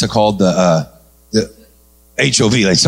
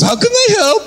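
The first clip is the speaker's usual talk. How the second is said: much higher eager Indian guy; high squeaky voice. Retold or imitated. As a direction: pitch way up, keen to help. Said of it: "He goes".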